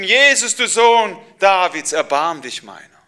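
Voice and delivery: loud, high cry for mercy; calling out. Acted. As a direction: call across the crowd, pleading. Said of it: "Und da habe ich gerufen".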